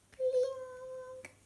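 very high, ringing "pling"; imitated tiny pling. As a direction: make a tiny high drop sound. Narrator imitates a tiny dropping. (tintinnio acuto imitato)

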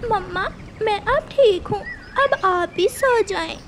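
put-on high small child voice; a tiny one reassuring mother; relieved little chick. A narrator's reading. Chick tells mother she is fine now, relieved.

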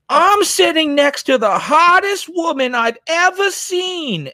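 high, drawn-out mock scream in a put-on voice; Bowser screaming. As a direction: scream it out slowly, imitating an overexcited admirer. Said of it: "and he just screamed".

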